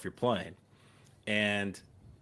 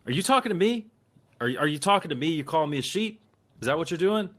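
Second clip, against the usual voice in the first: higher, louder challenge, hammed-up fury; retold angry confrontation; 7 semitones higher, 3 dB louder, faster than usual. Veteran confronting the old man who called him sheep, mock-furious. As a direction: get in his face and demand an answer.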